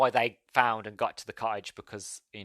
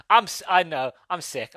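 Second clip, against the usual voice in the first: normal talking voice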